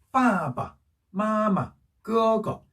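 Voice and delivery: 빠바, 마마, 끄거 with a long first syllable and a short light second; just touch the last syllable of 빠바, 마마, 끄거; 가벼운 경성. Shown as a demonstration. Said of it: "살짝 자연스럽게 살짝 발음만 해줬어요". (빠바, 마마, 끄거의 뒤 음절을 살짝만 발음)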